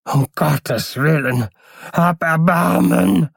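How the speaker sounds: high, slow, wailing plea; drawn-out desperate cry; voice begging from inside. A narrator's reading. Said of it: "rief eine Stimme von innen".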